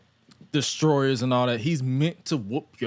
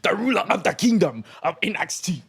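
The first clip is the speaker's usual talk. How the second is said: put-on TNA accent; 6 semitones higher than usual. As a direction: slip into the old TNA promo accent. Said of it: "peek back into his TNA accent".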